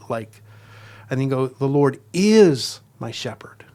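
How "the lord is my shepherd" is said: "is" held very long and loud; stress on is. Shown as a demonstration. Teacher demonstrating emphases in prayer, stress on "is". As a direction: lean hard and slow on "is", the rest lighter. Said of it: "you read it over with different emphases".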